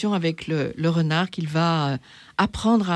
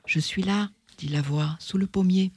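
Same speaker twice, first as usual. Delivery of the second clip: story read aloud. A narrator's reading. Reading Le Petit Prince aloud to the audience, in a storytelling voice. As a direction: read the tale to the audience, calmly. Reads story narration and fox.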